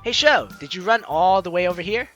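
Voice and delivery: normal talking voice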